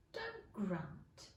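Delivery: put-on quiet reproach to the baby; soft scolding. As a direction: gently tell it off. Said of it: "said Alice".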